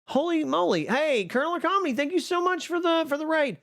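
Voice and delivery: high-pitched, flustered astonishment; real thrilled disbelief, voice way up high; excited surprise. Real, not acted. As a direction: squeal with surprise and gratitude at a raid. Surprised by a raid arriving.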